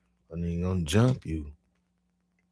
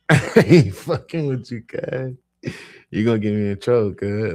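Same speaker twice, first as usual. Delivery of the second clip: loud, high, laughing through it; laughing scold; 5 semitones higher, 11 dB louder than usual. Retold or imitated. Narrator scolds his cousin while laughing loudly. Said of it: "I'm like".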